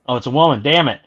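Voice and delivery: ordinary delivery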